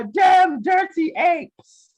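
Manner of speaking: high, shouted mock horror; hammed-up film line. Austin reporting on the future, hammed-up outburst. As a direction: shout it as an overblown movie quote. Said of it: "So, Austin, what's the future like".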